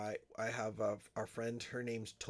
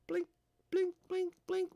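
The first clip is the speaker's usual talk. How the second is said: blinking sound; 3 dB louder than usual. Pretend.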